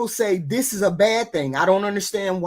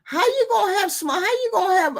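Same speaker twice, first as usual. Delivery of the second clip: much higher, challenging question to him; high retort; 12 semitones higher, faster than usual. Retold or imitated. To the panelist who said women lose value, high incredulous retort. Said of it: "and I said".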